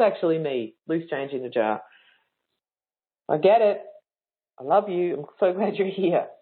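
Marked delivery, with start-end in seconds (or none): none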